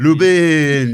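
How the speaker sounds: high, loud French Lubin; mock French shout. Guest repeating the movie line, loudly in a French accent. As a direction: belt out the name in French. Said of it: "he's like".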